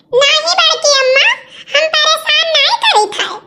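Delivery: put-on high childlike protest; a small girl insisting she's innocent; the girl denying to her aunt that she bothers her mother; little girl protesting. Child denies being a nuisance.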